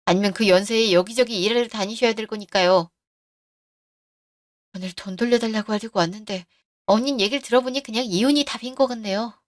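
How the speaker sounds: ordinary delivery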